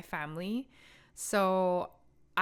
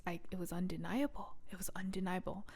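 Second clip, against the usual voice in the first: near-whispered, much softer insistence; hushed conviction; 8 dB quieter, faster than usual. Real, not acted. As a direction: drop to a hush for emphasis.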